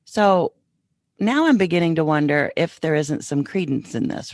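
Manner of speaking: normal talking voice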